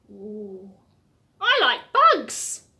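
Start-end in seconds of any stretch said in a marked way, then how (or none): 1.4-2.7 kiwi bird voice. Acted. Voicing Eddie Kiwi in the book.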